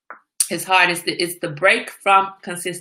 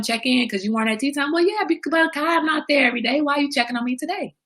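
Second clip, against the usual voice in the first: higher-pitched, quick protest; indignant mock retort; 9 semitones higher, faster than usual. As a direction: retort in a high, put-out voice. Voicing someone's retort about check-ins.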